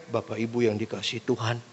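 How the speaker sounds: soft, low greeting to the congregation; sleep-inducing preacher. Acted. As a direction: murmur it like a dull preacher. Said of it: "tidur semua jemaatnya". (salam pelan dan rendah, bikin mengantuk)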